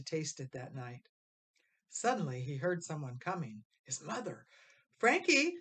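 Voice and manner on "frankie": high, loud call across the house. A narrator's reading. Mother calling her son from the kitchen, loud. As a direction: call his name from the next room. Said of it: "she called".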